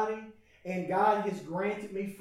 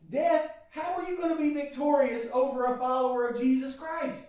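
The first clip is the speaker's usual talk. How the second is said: fiery preaching; 5 semitones higher than usual. Acted. Pastor to his church, preaching at full force.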